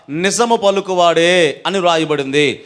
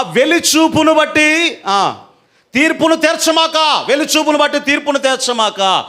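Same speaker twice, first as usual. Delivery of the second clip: high, emphatic command from the pulpit; forceful scripture preaching; 7 semitones higher than usual. Real, not acted. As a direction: proclaim the verse as a warning. Proclaims verse as a command. (ఉద్వేగంగా లేఖన ప్రకటన)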